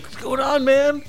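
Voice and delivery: high, airy hippie voice; a dopey stoned hippie greeting; lightly mocking art-fair hippie. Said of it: "expect you to be like".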